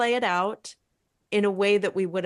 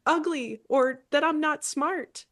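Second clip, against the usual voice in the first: younger self's hurt; 7 semitones higher than usual. Pretend. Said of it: "actually listening to yourself".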